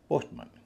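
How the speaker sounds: पोस्टमन with मन as a short schwa; reduced "mun" ending. Shown as a demonstration. Teacher giving the correct form, पोस्टमन with reduced मन. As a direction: shorten the ending to मन, not मैन. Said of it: "मैन न होके मन हो जाता है".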